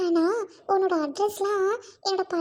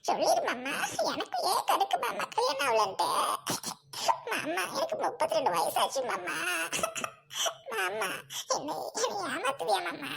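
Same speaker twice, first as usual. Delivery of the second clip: softer, broken crying lament; worn-down tearful pleading; sobbing plea; 5 dB quieter than usual. Acted. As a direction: beg him through hammed-up sobs.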